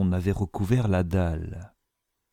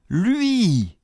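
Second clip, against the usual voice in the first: much higher, shrieked cry; screamed recognition; 12 semitones higher than usual. A narrator's reading. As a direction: shriek the word at the enemy. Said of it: "hurla-t-il".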